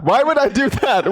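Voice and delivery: high, disbelieving repeat; incredulous. Real, not acted.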